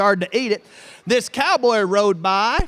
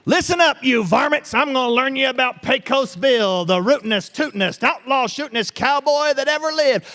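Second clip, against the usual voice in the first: hammed-up cowboy storyteller opening; folksy tall-tale storyteller; 4 semitones higher than usual. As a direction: rope the kids in like an old trail hand. Tall-tale storyteller addresses the kids.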